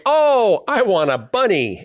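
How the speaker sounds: high, loud mock eager "oh"; eager wanting voice. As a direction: play a gullible buyer wanting it. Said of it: "the single people say".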